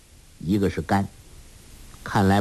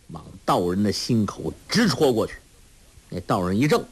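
ordinary delivery, nothing marked

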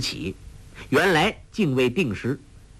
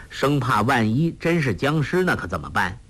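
ordinary delivery

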